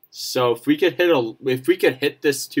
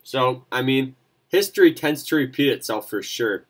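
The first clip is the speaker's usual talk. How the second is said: usual speaking voice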